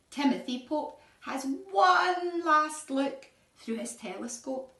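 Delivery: ordinary delivery, nothing marked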